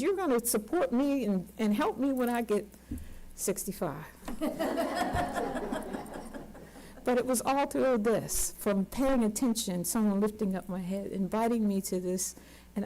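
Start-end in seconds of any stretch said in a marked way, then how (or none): 4.1-6.6 quiet airy chuckle at her own joke; soft breathy laugh. Real, not acted. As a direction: let a soft laugh slip out.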